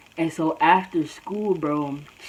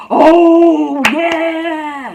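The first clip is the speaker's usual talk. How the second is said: high, loud, drawn-out realisation; mock sudden realisation; 11 semitones higher, 11 dB louder than usual. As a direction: exaggerate a sudden loud burst of remembering. Suddenly recalls the altercation, acted.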